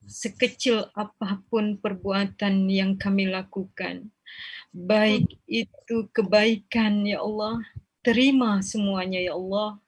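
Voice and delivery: measured chanted supplication with long pleading pauses; leading a prayer. Acted.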